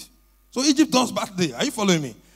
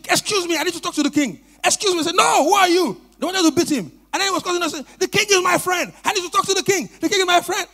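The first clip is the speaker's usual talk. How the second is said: calling out; 6 semitones higher than usual. Acted. Man pushing through guards, calling.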